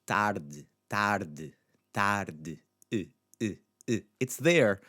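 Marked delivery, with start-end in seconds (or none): 0.1-2.6 "tarde" with the final e nearly vanishing after d; swallowed final e. Shown as a demonstration. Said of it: "our swallowed, unstressed". 2.9-4.0 short inward "uh", barely voiced vowel; swallowed unstressed e. Shown as a demonstration. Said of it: "it comes inward".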